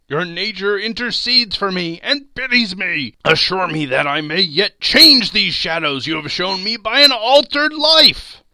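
high, loud entreaty; fervent, anguished pleading; imploring. A narrator's reading. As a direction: beg for mercy, swelling at the end. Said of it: "down upon the ground he fell before it".

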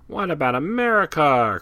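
high, loud worried cry; first mate pleading with Columbus. Acted. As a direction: call out in alarm. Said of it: "cried the first mate".

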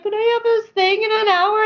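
a squeaky urgent protest; urgent plea. Retold or imitated. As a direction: press them, you have somewhere to be. Pressing the tire shop.

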